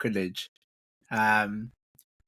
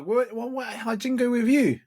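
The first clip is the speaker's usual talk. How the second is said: surprised; 12 semitones higher, 4 dB louder than usual. Acted. Mocking disbelief at bingo.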